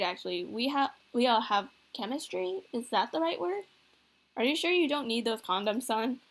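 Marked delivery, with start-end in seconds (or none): none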